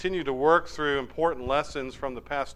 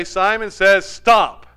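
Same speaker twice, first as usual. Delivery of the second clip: louder, slow, firm call; game leader command; 4 semitones higher, 9 dB louder, slower than usual. Retold or imitated. Simon ending the game for the group, louder and slow. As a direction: call the end of the round. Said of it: "you say".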